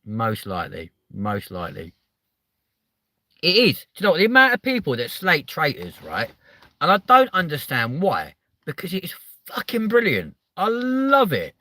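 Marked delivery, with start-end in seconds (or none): 3.4-11.5 higher and louder, raving about the show; real enthusiasm pouring out; excitedly defending a favourite show; gushing excitement. Real, not acted. Gushing about loving Traitors.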